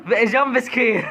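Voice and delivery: laughing. Real, not acted. Mock jump scare comment.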